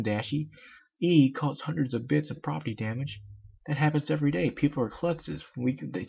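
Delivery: ordinary delivery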